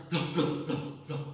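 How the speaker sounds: trailing to a whisper; soft astonished loh loh; puzzled murmur. Pretend. Pilgrim surprised hearing languages.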